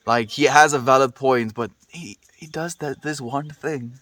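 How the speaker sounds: ordinary delivery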